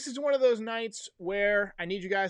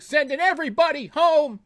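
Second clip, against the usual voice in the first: high, loud shout sending runners home; mock coach shout; 10 semitones higher, 10 dB louder than usual. Streamer playing a third-base coach waving runners in, mock shout. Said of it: "I'll be out there coaching third".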